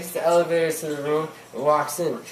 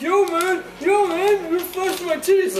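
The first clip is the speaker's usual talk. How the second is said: high, louder mock outburst; worked-up friend; 14 semitones higher, 4 dB louder than usual. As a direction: act out a friend going crazy, pitched way up. Acting out Biff's crazed reaction.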